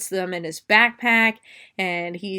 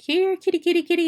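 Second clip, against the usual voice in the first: high sing-song cat call; old neighbour coaxing his cat from the vents, high and sing-song; 10 semitones higher, 4 dB louder, faster than usual. Retold or imitated. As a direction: coax the cat out, pitched up high. Old man calling his cat.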